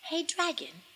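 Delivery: high, hushed little call; tiny, careful, quiet voice; very soft whisper. A narrator's reading. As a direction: call softly so as barely to disturb a sleeper. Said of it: "Elizabeth whispered very softly".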